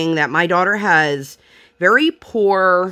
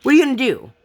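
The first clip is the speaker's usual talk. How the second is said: higher, louder repeat of the challenge; rising imagined yell; 7 semitones higher, 4 dB louder, much faster than usual. Retold or imitated. Mom imagines snapping at the nosy lady, louder. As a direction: push it up the second time. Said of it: "I wanted to be like".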